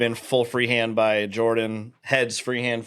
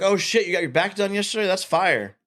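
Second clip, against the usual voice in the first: higher-pitched retold admiration; imitated impressed reaction; 7 semitones higher than usual. Storyteller voices his old boss admiring his back tattoo, impressed. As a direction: voice someone impressed by a friend's new ink.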